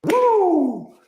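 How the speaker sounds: high, hammed-up celebration; jubilant whoop. Reporter celebrating a scoop at last, jubilant. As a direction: whoop and crow in triumph.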